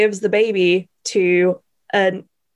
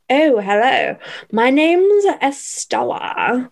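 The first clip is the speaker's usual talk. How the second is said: mocking put-on posh London accent, higher and slow; imitating Emma Stone's accent; 4 semitones higher, slower than usual. Host imitating Emma Stone's accent as Estella in a mock posh London accent. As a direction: drawl it slowly, like a forced movie accent. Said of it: "she kind of talks like this".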